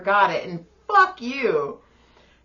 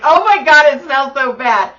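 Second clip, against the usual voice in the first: louder "it smells so bad"; loud gross-out; hammed-up disgust; mock disgust; 5 semitones higher, 12 dB louder than usual.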